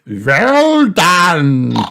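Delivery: loud, high, theatrical praise; swooping vampire congratulation; vampire grandpa. A narrator's reading. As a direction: boom it like a hammy vampire. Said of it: "said Vampire Grandpa Pig".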